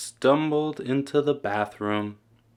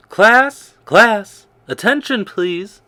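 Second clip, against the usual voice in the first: much higher, loud teacher call; calling the class; 9 semitones higher, 11 dB louder, slower than usual. A narrator's reading. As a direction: call the room to order. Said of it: "said Missus Burton".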